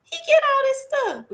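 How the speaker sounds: high, wondering aside; a hushed, amazed high voice; Ogun amazed. Acted. Ogun watching Obatala's wealth pass, amazed and hushed. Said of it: "And Ogun was like".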